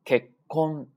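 結婚 as kek-kon with a silent beat between; one-beat pause. Shown as a demonstration. Teacher shows the empty beat, held stop. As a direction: stop after ke, wait, then release kon of 結婚. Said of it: "是不是空了一拍儿啊".

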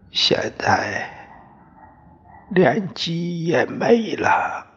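a hoarse voice dropping away at the end; hoarse old father. Acted. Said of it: "爹的声音嘶嘶的".

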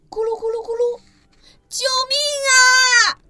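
put-on a baby dinosaur falling, calling for help; panicked little scream; cry for help. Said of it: "尼大叫".

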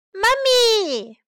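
high, loud call for mother; crying out. Acted. May falls and calls her mother, loud high cry. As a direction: call out for help after a fall. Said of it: "May cries".

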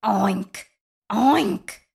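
oink like a storybook pig. A narrator's reading. Pig greets the spider with grunted oinks. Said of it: "grunted the pig".

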